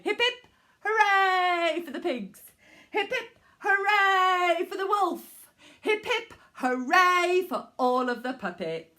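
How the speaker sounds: loud, high hip hip hooray; cheering. Acted. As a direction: cheer at the end of the show. Closing cheers.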